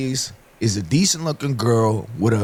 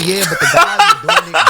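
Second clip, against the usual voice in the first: high mocking voice through laughter; laughing; 7 dB louder than usual. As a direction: quote them while cracking up. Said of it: "I know somebody saying".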